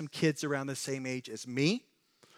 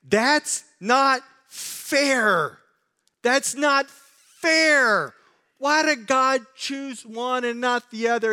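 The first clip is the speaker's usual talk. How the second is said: high, slow, whiny protest; drawn-out whine of unfairness; mock complaint; 9 semitones higher, 8 dB louder, much slower than usual. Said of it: "you may say".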